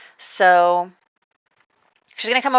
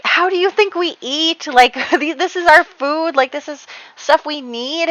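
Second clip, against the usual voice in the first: much higher, loud mock exasperation; mock angry retort; 10 semitones higher, 3 dB louder than usual. [name] arguing with Abigail about their food, mock exasperated. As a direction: argue back like a fed-up older sister. Furious sister arguing back.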